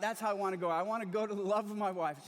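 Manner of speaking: ordinary talk, nothing marked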